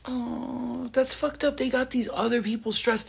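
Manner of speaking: high, drawn-out 'oh' of dismay; pitying reaction. Retold or imitated. Reacting to the girl being stressed, pitying. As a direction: retell it in a high, sorry voice.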